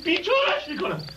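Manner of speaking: furious shouting. Acted. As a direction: bellow the threats of revenge. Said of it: "یواش".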